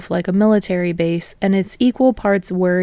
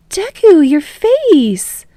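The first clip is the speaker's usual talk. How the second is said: high, loud alarmed cry; shocked shout; 10 semitones higher, 6 dB louder, much slower than usual. A narrator's reading. Classmate crying out at Deku's injured face. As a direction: yelp it out in shock.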